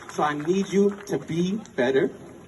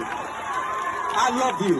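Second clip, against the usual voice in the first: tender; 5 dB quieter, much slower than usual. Real, not acted. Very slow tender repeat.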